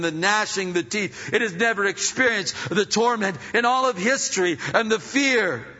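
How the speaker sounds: loud, high, fast building warning; preaching climax. Real, not acted.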